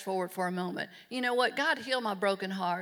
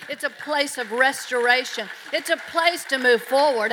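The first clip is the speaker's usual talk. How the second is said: louder and louder, pounding each "place"; rising preacher shout; 3 semitones higher, 9 dB louder than usual. Acted. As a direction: build the repeats to a ringing climax. Repeated line rising to shout.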